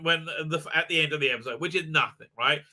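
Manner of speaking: ordinary delivery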